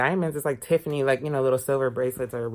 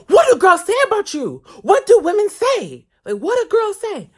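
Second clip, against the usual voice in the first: high, loud mocking voice of a prying older woman; scandalized nosy lady; 11 dB louder than usual. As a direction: grill him like a scandalized auntie. Lady pressing again.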